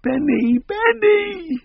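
very high, piercing wail of the name; children screaming for their dog from the back seat; piercing child's cry. Acted. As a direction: shriek it like a distraught child. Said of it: "the piercing cries from the back".

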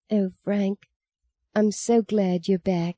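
soft, slow and tender. Acted. Girl comforts weeping brother.

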